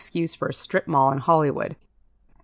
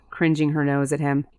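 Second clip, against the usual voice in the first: usual speaking voice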